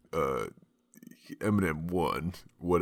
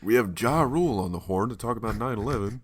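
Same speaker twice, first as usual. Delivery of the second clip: comedian's talk show voice, higher and louder; 4 semitones higher, 4 dB louder than usual. Retold or imitated. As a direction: announce it like a TV host. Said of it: "[name] talking about [name]".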